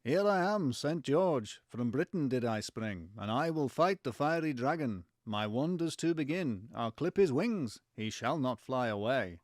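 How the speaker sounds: slow, lilting mock Liverpool [name]; generic [name] accent. Saint George vows to fight the dragon, generic [name] accent. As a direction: declaim the rhyme as a stock [name], Liverpool accent. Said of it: "generic [name]".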